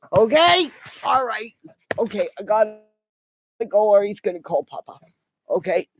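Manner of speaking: ordinary delivery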